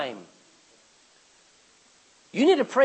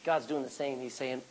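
normal talking voice

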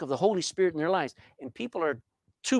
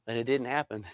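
ordinary delivery

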